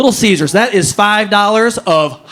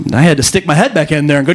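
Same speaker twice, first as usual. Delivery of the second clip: normal talking voice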